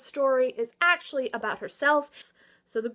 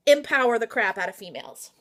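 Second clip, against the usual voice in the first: ordinary delivery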